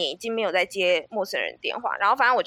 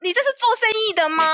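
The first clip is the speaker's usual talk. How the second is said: high, fast, exasperated comeback; mock-indignant "is this how you do business?"; indignant retort; 9 semitones higher, faster than usual. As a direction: fire it back at the pushy caller. Retold angry retort to caller.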